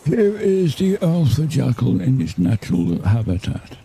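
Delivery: slow hushed wildlife documentary narrator; slow, reverent nature documentary voice; David Attenborough impression. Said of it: "So it's a bad impression of David Attenborough".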